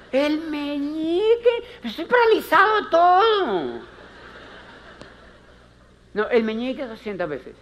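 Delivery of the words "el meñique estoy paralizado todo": high, strained patient's complaint; a much higher, louder mock patient whining; whiny patient. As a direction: protest like a paralysed patient.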